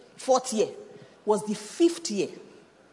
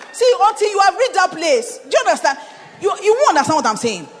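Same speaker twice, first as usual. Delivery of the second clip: loud urging; 9 semitones higher, 8 dB louder than usual. Real, not acted. Preacher urging congregation.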